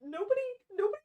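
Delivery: flustered, quick ban on teasing; embarrassed protest. Real, not acted. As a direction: shut the topic down in a flustered rush. Embarrassed, forbids further teasing.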